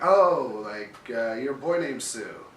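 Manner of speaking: retold thrill at the song reference, loud and high; delighted recognition. Hears a man is named Sue.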